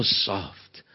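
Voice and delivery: very slow, low hush fading almost to a whisper; slow right down and speak tenderly, barely above a breath; soft, reverent. Retold or imitated. Preacher recalling a soft-spoken Scottish minister who moves him, hushed. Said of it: "Hear how soft the man from Scotland speaks".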